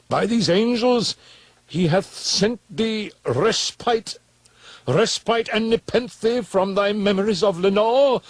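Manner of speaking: high, loud pleading cry that slows at the end; a frantic plea, easing off on the last words; impassioned cry. Acted. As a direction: beg him desperately to forget. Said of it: "I cried".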